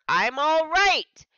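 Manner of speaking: high, loud, slow yell. Acted. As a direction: draw out the yell to reassure her. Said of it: "Briggs yelled to reassure her".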